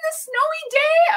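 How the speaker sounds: a chirpy postman's sing-song greeting; upbeat postal guy. Retold or imitated. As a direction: imitate the cheery mailman. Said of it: "he's, like, so upbeat".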